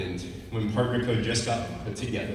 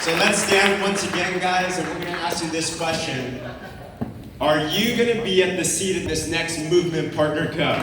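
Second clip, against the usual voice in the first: rally call; 5 semitones higher, 4 dB louder than usual. Acted. Rallies crowd to stand.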